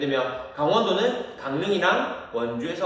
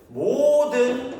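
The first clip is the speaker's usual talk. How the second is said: "모든" stretched very slowly, higher and louder; drawn-out emphasis; 8 semitones higher, 4 dB louder, much slower than usual. Shown as a demonstration. Teacher stressing to students that all counties got officials. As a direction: lean hard on "모든".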